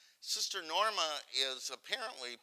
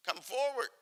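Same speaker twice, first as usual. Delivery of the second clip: high, beckoning imitation of the Pope; Pope Francis beckoning; 11 semitones higher, 4 dB louder than usual. Moderator quoting the Pope inviting the sister forward, high beckoning call. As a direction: call her up the way the Pope did, lightly voiced. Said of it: "I can't do the accent".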